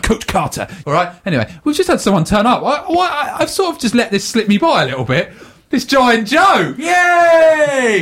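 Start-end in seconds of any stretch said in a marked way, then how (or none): 5.7-8.0 high, drawn-out announcement into a cheer; cheering welcome. Pretend. Host hails a guest who turned up, cheering. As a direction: hail the guest with a big yay. Mock fanfare for surprise guest.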